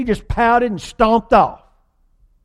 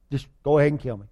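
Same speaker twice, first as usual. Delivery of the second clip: mock despairing Jonah; 5 semitones lower, 6 dB quieter, much faster than usual. Preacher voicing Jonah, sunk in sulky despair. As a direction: lower and softer, a sulky mutter of a wish to die. Jonah repeated, muttered low.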